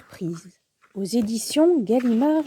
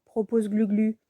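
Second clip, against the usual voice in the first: usual speaking voice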